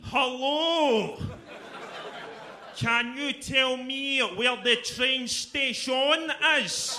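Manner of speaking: high, loud, slow over-enunciated menace; threatening over-enunciation. Pretend. Glaswegian asking a stranger for directions, over-enunciated menace. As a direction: enunciate every word so it sounds like a threat. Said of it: "you just sound more fucking threatening".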